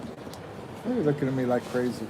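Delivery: ordinary talk, nothing marked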